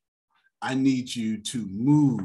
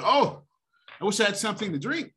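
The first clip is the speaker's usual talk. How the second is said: higher, with a lightly voiced wishful Oh; man in the story; 10 semitones higher, faster than usual. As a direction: gently act out the man wishing for a drink.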